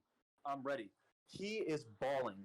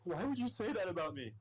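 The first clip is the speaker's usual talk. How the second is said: higher, plaintive retold protest; imitating crying; 5 semitones higher than usual. Upset friend asking about the insults, imitated crying. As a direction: mildly mimic a tearful friend's hurt question. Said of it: "Like, crying".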